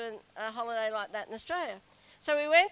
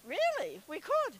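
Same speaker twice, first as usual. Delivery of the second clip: mock-eager squeal, much higher; excited high reply; 10 semitones higher than usual. Retelling the sister-in-law's eager answer, a high excited squeal. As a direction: play the sister-in-law jumping at it, high and bright.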